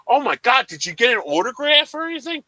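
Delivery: loud, high burst of excitement; excited fan. Retold or imitated. Son asks mom about his sports idol, excited. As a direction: gush like an eager fan. Acted fan reaction to mom's news.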